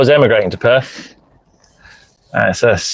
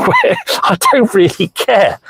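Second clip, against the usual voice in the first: higher, breaking into a real laugh; 8 semitones higher than usual. Real, not acted. Driver shrugging off criticism to a livestream viewer, laughing. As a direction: laugh breaking through mid-sentence.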